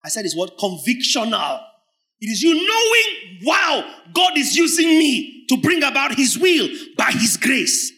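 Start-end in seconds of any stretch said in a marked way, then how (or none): 2.2-7.9 loud, high, awed shout; exultant shout of wonder; joyful conviction. Acted. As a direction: proclaim God's work with joy. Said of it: "That's cheer".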